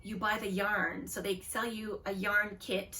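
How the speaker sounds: ordinary delivery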